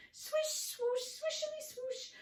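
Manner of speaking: very high, racing swish chant. Acted. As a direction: squeak it out at top speed. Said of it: "faster, faster".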